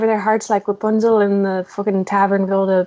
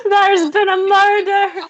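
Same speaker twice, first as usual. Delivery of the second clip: higher, laughing Scottish proclamation; mock Scottish detective announcing a murder; half-laughing Scottish cry; Scottish accent; 9 semitones higher, 3 dB louder than usual. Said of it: "Why am I Scottish now?".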